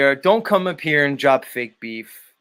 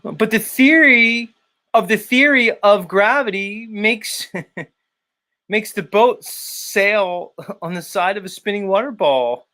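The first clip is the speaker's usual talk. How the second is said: higher, slow pompous mock, breaking into laughs; Yurt voice; 7 semitones higher, slower than usual. Host reading chat as the rival streamer, laughing. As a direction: lecture it slowly like a smug fool, laughing. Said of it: "let me do it in the, uh, Yurt voice".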